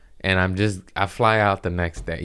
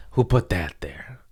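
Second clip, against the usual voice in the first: a real muttered aside; whispered aside; 2 semitones lower than usual. Real, not acted. Host muttering to himself after bumping something, whispered. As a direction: whisper under his breath.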